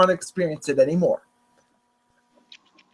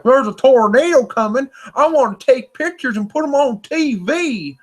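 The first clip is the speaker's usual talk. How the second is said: mock Oklahoma local, excited and higher; Oklahoma accent; 7 semitones higher than usual. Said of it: "people who have accents like that".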